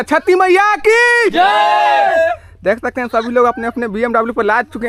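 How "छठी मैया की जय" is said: high, loud, very slow drawn-out shout; festival slogan shout. Real, not acted. Vlogger rallies the villagers with a loud, stretched festival shout. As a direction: belt out the slogan so the whole crowd joins. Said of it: "एक बार सभी लोग नारा लगाइएगा".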